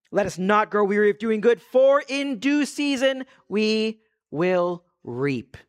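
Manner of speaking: slow, drawn-out reading of the verse; scripture reading. Acted. Pastor rereading the verse to the church, slowly. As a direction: let the promise land slowly.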